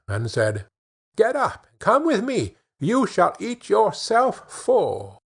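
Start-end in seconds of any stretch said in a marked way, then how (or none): 1.2-5.2 high, bright-spirited invitation, last words slowed; glad. A narrator's reading. Dummling cheerfully invites the hungry man along, high and glad. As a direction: lift it up gladly and draw out the promise. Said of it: "At this Dummling was glad".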